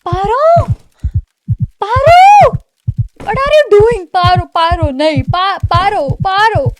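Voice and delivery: frantic high, loud shouts of her name; panicked yelling. Real, not acted. Panicked yelling at Paro hitting her head. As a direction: scream at her to stop in panic.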